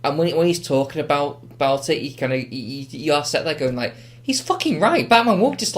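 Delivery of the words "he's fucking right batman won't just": high-pitched mock outburst of agreement; excited realisation. Viewer sat watching the villain's speech, excited realisation. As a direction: blurt it out as a won-over viewer. Acting out the convinced viewer's reaction.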